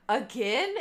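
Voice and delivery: loud, rising disbelief; retold incredulous aunt. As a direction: imitate an aunt who can't believe it. Aunt's incredulous reaction, retold.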